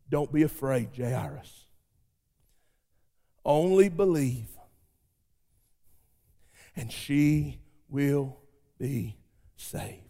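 soft, low and slow comfort; Jesus soothing a weeping father, soft and slow; gentle reassurance. Acted. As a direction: hush the grieving father gently.

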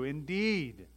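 "Indeed" with a long, swooping second syllable; emphatic "Indeed". Acted. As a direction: proclaim "Indeed" with conviction.

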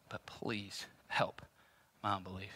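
hushed, slow whisper; whispered prayer. Acted. Believer whispering the last plea to the Lord. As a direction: breathe the plea almost silently. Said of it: "you're gonna have to say".

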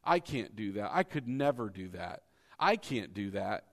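a hint of a low, defeated complainer; whiny doubter. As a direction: lightly mimic someone saying they can't. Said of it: "how many times I hear".

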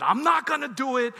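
loud, high mock wail; hammed-up tantrum protest; weeping refusal. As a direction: imitate a child's tearful refusal, loudly. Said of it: "she wept and said".

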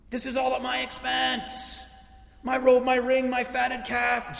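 a hint of the sulky older son counting his losses; resentful older brother. Older brother protests to the father, mildly aggrieved.

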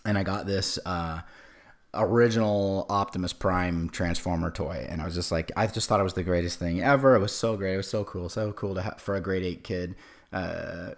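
ordinary talk

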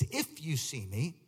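slow reading with "If" punched; deliberate, drawn-out emphasis from "If" onward; stress on "If". Shown as a demonstration. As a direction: make the condition "If" stand out. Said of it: "I read it with emphasis".

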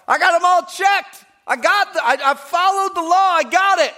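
high, loud, shouted bragging; boastful rich young ruler. Retold or imitated. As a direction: crow proudly that you kept every rule.

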